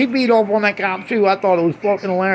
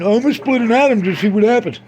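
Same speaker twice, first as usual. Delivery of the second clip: lightly voiced Einstein; 5 semitones higher than usual. Comic telling Einstein's thought, lightly higher. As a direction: toss it off like an eager scientist.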